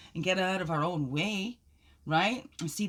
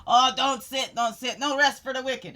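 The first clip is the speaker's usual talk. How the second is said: playful high scold, louder; mock scolding; 8 semitones higher, 10 dB louder than usual. Scolding herself not to sit down, playful mock scold. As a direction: tease yourself like a stern nanny.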